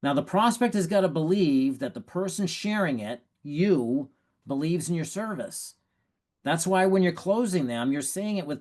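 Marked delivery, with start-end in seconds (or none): none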